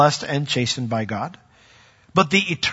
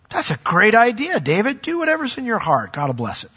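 eager prophet; 8 semitones higher than usual. Acted. Prophet voiced eagerly approving David.

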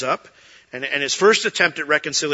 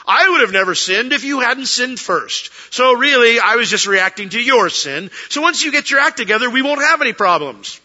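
much higher and louder, self-righteous; mock blaming husband; 7 semitones higher, 6 dB louder than usual. Husband blaming his wife in a fight, mock self-righteous and loud. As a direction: ham up the blame-shifting apology. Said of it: "That's blame".

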